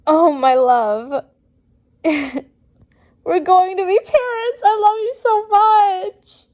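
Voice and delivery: overjoyed at becoming a parent; utter happiness. Acted. Severus hearing he'll be a father, joyful and laughing. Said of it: "complete and utter happiness".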